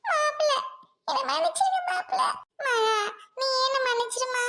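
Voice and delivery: tearful plea. Acted. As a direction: sob out the apology to son-in-law and Maha. Aunt begs forgiveness in tears. (அழுகையுடன் மன்னிப்பு கேட்கும் குரல்)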